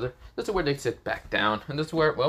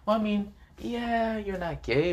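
higher, drawn-out mock concession; lightly amused, pitched-up tease; teasing, chuckling manner; 10 semitones higher than usual. Issei needles an angry rival, mildly amused and teasing. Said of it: "Issei chuckles and says".

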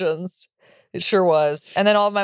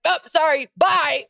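high, chirped mock apology; caught-out mom; 8 semitones higher, slower than usual. Mom caught gossiping on the phone, high hurried-off goodbye. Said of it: "My mom's like".

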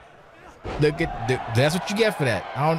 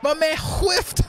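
higher, stretched shouted jeer; mocking rant; 7 semitones higher than usual. Real, not acted. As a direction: savor each miss as you mock. Ranting at wrestler's whiffs.

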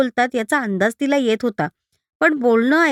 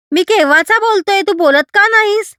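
put-on high, loud angry child demanding; a boy shouting at his silent mother; 9 semitones higher, 5 dB louder than usual. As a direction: shake her and yell it out. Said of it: "त्याला राग आला".

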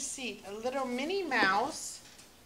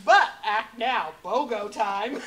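home shopping host; 8 dB louder, slower than usual. Pretend. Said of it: "make an infomercial of it".

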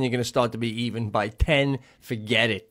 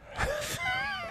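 high laugh; 7 dB quieter than usual. Real, not acted. Friend laughs at ice skating joke.

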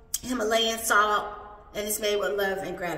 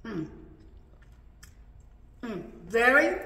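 humming while chewing food; closed lips, food in mouth; mouth full, chewing. Real, not acted.

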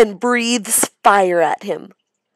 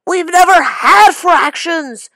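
high, loud protesting shout; 10 semitones higher, 11 dB louder than usual. Acted. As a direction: yell it out in protest. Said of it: "shouts Derek Bloom".

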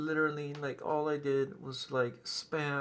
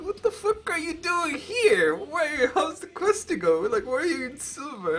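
much higher, loud, laughing mock-outrage; imitating uncle; 11 semitones higher, 8 dB louder than usual. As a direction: squawk it like the startled uncle. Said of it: "and he's like".

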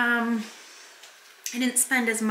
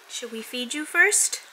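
ordinary talk, nothing marked

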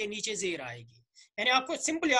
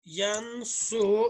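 slow demo; much slower than usual. Shown as a demonstration. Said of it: "यनसुरु means उसने मदद की".

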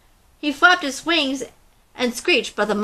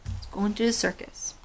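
quiet aside; 2 semitones lower, 9 dB quieter than usual. A narrator's reading. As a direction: drop it to a hushed aside.

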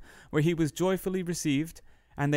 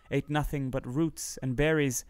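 ordinary delivery, nothing marked

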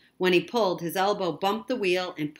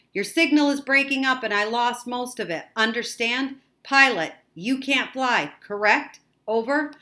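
raised voice over a bad signal; higher, louder, questioning radio voice; radio operator; 6 semitones higher, 7 dB louder than usual. A narrator's reading. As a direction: call out loudly, checking you understood. Operator checks over failing signal.

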